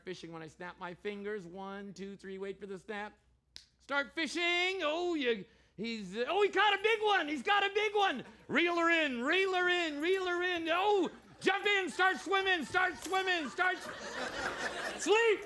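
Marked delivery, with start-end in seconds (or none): 3.8-15.0 high, loud, breathless cheering; excited play-by-play. Real, not acted. Hyped commentary on fishing subjects. 15.0-15.4 very high, loud snap command, laughing; commanding shout. Real, not acted. Hypnotist drops subjects.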